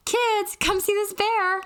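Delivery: put-on high, slow, delighted call to the kids; excited mom. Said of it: "and then goes".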